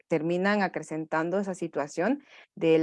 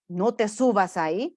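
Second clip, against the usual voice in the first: a parent's warning, given as the negative example. Shown as a demonstration. Parent warning child off the climb, mild scolding. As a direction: lightly play the parent saying it. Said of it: "Evitemos los mensajes negativos".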